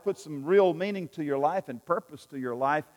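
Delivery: usual speaking voice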